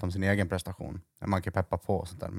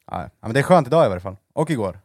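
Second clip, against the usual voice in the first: normal talking voice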